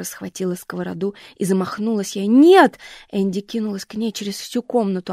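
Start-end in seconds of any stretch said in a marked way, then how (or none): 2.3-2.8 пронзительный громкий крик; выкрикни изо всех сил. Acted. Энди кричит, бросаясь. (дочь громко и высоко кричит на мать)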